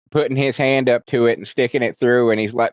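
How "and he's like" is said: usual speaking voice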